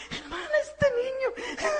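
high, slow, exasperated mother's plea; complaining church sister. Retold or imitated. Church sister complaining to the preacher about her son, high and drawn out. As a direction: whine it up high like a fed-up mom. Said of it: "una hermana en la iglesia".

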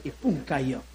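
ordinary talk

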